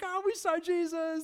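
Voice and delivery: high, bright-eyed gush of joy; excited. Acted. Disciples telling Thomas, excited high gush. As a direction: gush it like great news. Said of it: "They're excited".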